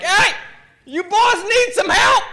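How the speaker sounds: high, loud mock holler from afar; hollered offer. Volunteer firefighter calling down to boys in the river, hollering. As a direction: call down high and drawn out, imitating rural rescuers.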